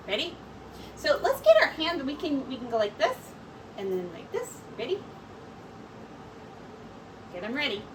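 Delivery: usual speaking voice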